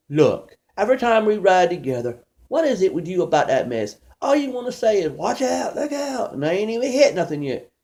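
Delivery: fed up with the passenger's nagging; exasperated complaint. Retold or imitated. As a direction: driver scolds jumpy passenger, exasperated. Driver scolding annoying passenger.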